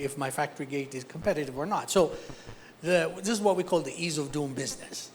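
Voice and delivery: usual speaking voice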